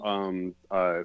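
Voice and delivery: ordinary talk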